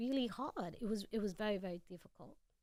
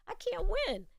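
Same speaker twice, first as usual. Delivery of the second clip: exasperated; 11 semitones higher, 4 dB louder, slower than usual. Retold or imitated. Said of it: "And I'm like".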